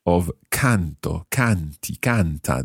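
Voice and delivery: canto, canti, canta, each hit hard on CAN, then falling; slow model with CAN stressed in canto, canti, canta; stress on first syllable. Shown as a demonstration. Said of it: "Just watch the stress".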